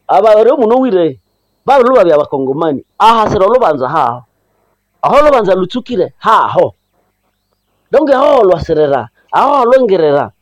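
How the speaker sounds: normal talking voice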